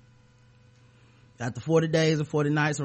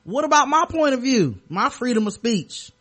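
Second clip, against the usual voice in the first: mock indignation; 8 semitones higher, 4 dB louder than usual. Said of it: "mocking the bigot's complaint".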